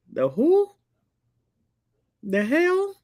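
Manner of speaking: very high-pitched mock bewilderment; high, incredulous comic query; baffled disbelief. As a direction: squeal the question in exaggerated confusion. Acted failure to understand.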